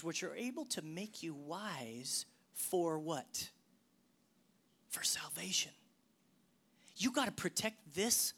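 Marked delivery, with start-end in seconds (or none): 0.0-5.7 fading to a whisper. Acted. Preacher reading to the church, fading to a whisper. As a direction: quote sinks to a hushed whisper.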